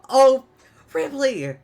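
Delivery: loud, high-pitched startled cry; startled scream. Acted. Drow to the sneaking Ripley, shrieking in surprise. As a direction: yelp in shock at who just snuck up. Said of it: "you hear [name] scream".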